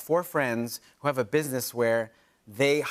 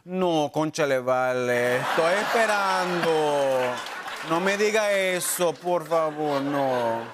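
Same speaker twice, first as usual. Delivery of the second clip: Venezuelan accent; slower than usual. Shown as a demonstration. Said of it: "the tone of Venezuela".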